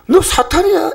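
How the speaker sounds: high-pitched, sudden denunciation; acted-out direct rebuke; blunt accusation. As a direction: fire it straight at him. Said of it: "바로 직격탄을 날리는 겁니다".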